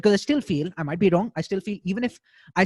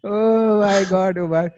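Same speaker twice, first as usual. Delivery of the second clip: loud, long drawn-out exclamation; mock-appalled reaction; 4 semitones higher, 6 dB louder, much slower than usual. Real, not acted. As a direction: stretch it out in loud, high disbelief. Reacting to the bad accent attempt.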